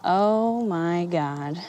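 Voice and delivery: quiet astonished oh my God; stunned realization. Retold or imitated. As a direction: let it sink in slowly. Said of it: "And I was like".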